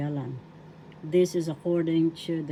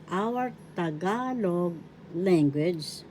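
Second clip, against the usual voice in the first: ordinary talk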